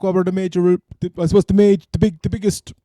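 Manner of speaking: normal talking voice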